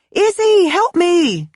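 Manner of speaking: very high, loud cry for help; shouting for help. Acted. Kenzie calling her roommate from the door, panicked shout. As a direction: yell over your shoulder in panic. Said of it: "she shouted over her shoulder".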